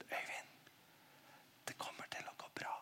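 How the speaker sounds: barely audible, reassuring whisper; whisper in the ear. Acted. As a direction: whisper it close, as comfort for one listener. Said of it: "så hvisker han inn i øret mitt". (hvisket trøst i øret)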